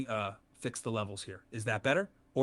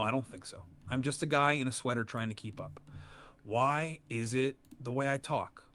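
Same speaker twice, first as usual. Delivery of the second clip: normal talking voice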